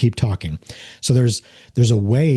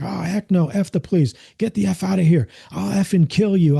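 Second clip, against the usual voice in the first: high-pitched angry ranting, imitated; imitated hostile outburst, pitched up; mock screaming; 9 semitones higher than usual. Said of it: "they're screaming at me like".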